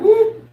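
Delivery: quick high-pitched whoop; playful whoop. Retold or imitated. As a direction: let out a short, high mock whoop. Voices whoop from chat message.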